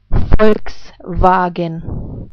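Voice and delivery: Volkswagen said slowly the German way, F-sound start and V-sound middle. Shown as a demonstration. Teacher demonstrating the German word slowly for English learners. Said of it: "let's put this all together".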